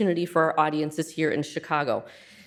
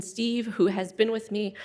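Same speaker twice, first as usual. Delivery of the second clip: ordinary talk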